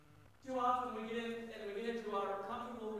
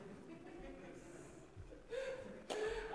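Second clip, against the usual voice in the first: laughing; 12 semitones higher, 7 dB quieter than usual. Real, not acted. Laughs at own joke.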